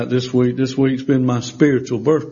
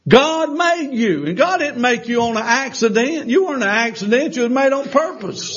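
full-force preaching; 7 semitones higher than usual. Acted. Preacher telling the church God made them, soaring. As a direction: lift the room, stretching each phrase. Preaching climax about God's purpose.

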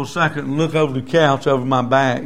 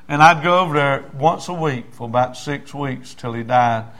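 normal talking voice

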